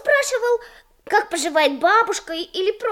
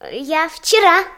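ordinary talk

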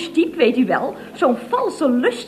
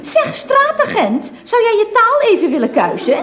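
indignant scolding; 6 semitones higher, 5 dB louder than usual. Acted. Indignant woman scolding policeman.

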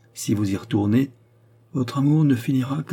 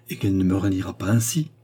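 usual speaking voice

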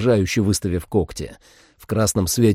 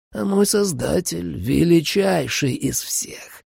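vampire voice; 6 semitones higher, slower than usual. A narrator's reading. Vampire menaces the hero.

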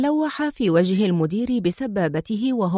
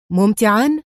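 indignant; 4 dB louder than usual. A narrator's reading. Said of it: "صاح في استنكار".

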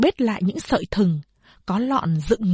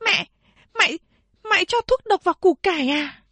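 put-on high, pleading child's voice; frightened young girl; 10 semitones higher than usual. A narrator's reading. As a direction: ask in alarm like a scared child. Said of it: "Chị ba tôi nói". (chị ba hỏi mẹ, giọng bé gái hoảng sợ)

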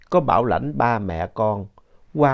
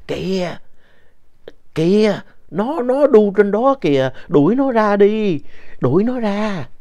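put-on high, loud, slow panicked old woman's cry; imitating grandma's frightened shout; 10 semitones higher, 5 dB louder than usual. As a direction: cry out in terror at something on the wall. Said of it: "Ngoại mới la lên".

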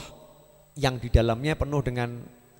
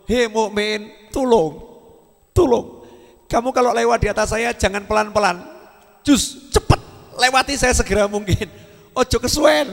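higher, louder pleading voice of hell; complaining hell; 8 semitones higher, 6 dB louder than usual. Acted. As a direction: hell begging the believer to hurry past. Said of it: "Neraka itu sambat, nerakanya itu ngeluh". (neraka memohon, suara tinggi dan keras)

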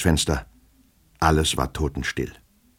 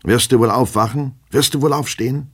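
higher-pitched, rising impatient demands; insistent scolding; 8 semitones higher, 3 dB louder than usual. Acted. Master rousing his sleeping servant, scolding and insistent. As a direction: order the sleeper up, pitch climbing.

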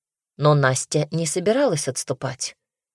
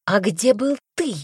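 high, sharp furious question; 6 semitones higher than usual. Acted. Разъярённая Настя набрасывается на брата с вопросом. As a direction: snap at him in rage. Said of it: "рассвирепела Настя".

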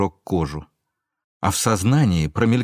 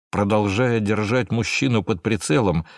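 plain reading voice

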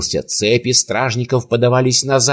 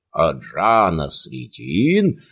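plain reading voice

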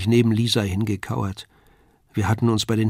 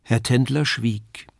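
plain reading voice

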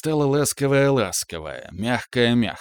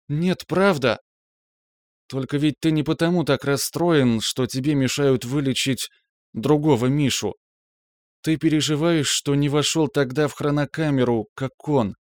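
put-on raised, gentle girlfriend voice; young woman, tender and earnest; 4 semitones higher than usual. A narrator's reading. Girlfriend comforting her upset man, tenderly.

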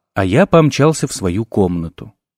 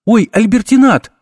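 high, louder startled squeak; startled babble; 10 semitones higher, 4 dB louder than usual. A narrator's reading. Dean caught undressed gasps at the intruder, high startled babble. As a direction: squeak out the name in flustered surprise. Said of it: "пролепетала деканша".

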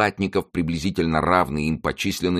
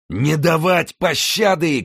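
very high, drawn-out cry; fierce shout; 12 semitones higher, 4 dB louder, slower than usual. A narrator's reading. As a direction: shout a merciless order from afar. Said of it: "крикнул по-французски в ответ Винтеру". (свирепый протяжный крик)

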